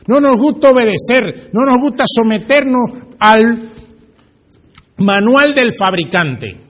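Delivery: slow, deliberate reproof; emphatic preaching. Acted. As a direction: weigh each phrase for the congregation. (predicando con énfasis, pausado)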